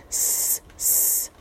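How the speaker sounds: drawn-out breathy hiss with no voice; soft unvoiced snake-like hiss from Gollum; creature hiss. A narrator's reading. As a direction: hiss through the teeth like a snake.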